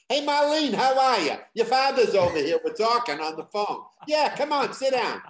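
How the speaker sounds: put-on loud, high Boston accent greeting. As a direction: holler it like Boston family at home. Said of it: "learned how to lose my Boston accent".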